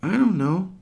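put-on high, breezy child voice; little girl answering. Sage answering her brother, high and breezy. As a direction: shrug it off. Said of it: "Sage answers".

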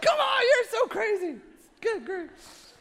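high, laughing mock protest. Husband laughing at his wife's change of heart. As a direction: laugh through it, teasing. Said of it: "I'm like".

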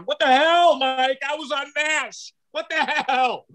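high-pitched mock outrage; indignant Jamie Farr. Comic imagines a snubbed TV actor complaining to Mike, indignant. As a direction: protest like a slighted sitcom actor. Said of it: "he's probably going".

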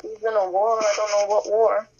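ordinary talk

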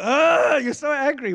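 loud, high mock growl easing into words; playful snarl in retort; growling back. Preacher answering the driver's growl, exaggerated growl. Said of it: "the more I growl back at him".

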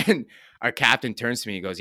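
ordinary delivery

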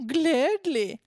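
high, loud camp German oinker; camp German pig. Acted. Bricklayer pig agreeing to play along, camp German voice. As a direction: make it a hammed-up German piggy. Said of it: "So really camp, offensive German oink".

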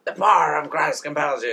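high, loud commanding priest; shouting priest. Retold or imitated. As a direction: bellow it like an exorcist. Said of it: "he's all sh-, shouty".

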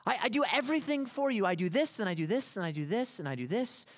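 high, loud exasperated snap; snapping wife. Retold or imitated. Goldie snapping at Tevye over years of chores, loud exasperated outburst. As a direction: fire back every chore like a wife fed up. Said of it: "she kind of snaps".

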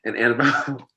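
ordinary talk

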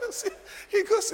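amused, laughing as you preach. Real, not acted. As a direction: talk through a chuckle. Preacher laughs while teaching.

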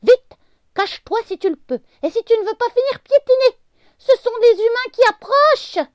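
very high, loud squeaky alarm; panicked warning. A narrator's reading. The worm warning the leaf humans are coming, high squeaky panic.

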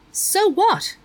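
high, loud defiant retort; defiant demand. A narrator's reading. Meg defying the principal, loud and high-pitched. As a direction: fling it back at him. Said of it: "Meg demanded".

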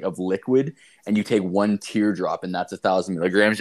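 usual speaking voice

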